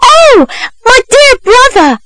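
high-pitched cry of false recognition; acted scream. A narrator's reading. Young woman suddenly hailing a lost brother, acted high-pitched scream. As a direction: cry out in exaggerated sisterly shock. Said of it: "suddenly screamed".

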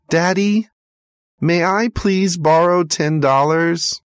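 put-on high, hopeful child's voice; timid little boy. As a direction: ask shyly like a small boy. Boy asks father for money.

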